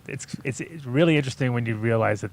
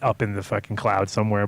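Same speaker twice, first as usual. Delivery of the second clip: ordinary delivery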